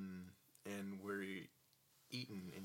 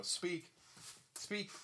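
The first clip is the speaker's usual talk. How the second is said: talking to a dog; 8 semitones higher than usual. Real, not acted. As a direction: urge the dog to bark. Commanding dog to speak.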